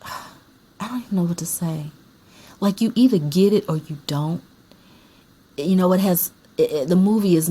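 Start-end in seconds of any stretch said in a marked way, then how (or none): 0.0-1.9 sigh, then a breathy whisper; lost for words, whisper it after a sigh. Real, not acted. At a loss over movie.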